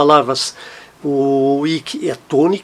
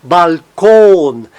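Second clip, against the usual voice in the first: "balcão" slowly and louder on the final syllable; stress on last syllable; 5 dB louder, slower than usual. Shown as a demonstration. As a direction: stretch and hit the end of "balcão". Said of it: "a sílaba tônica no final".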